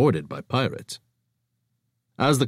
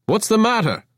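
breezy innocence; 13 semitones higher, 4 dB louder than usual. Acted. Avra's cheerful high voice.

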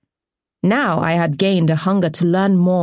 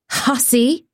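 shrieked insult; 7 semitones higher than usual. Acted. Voice in jeering mob.

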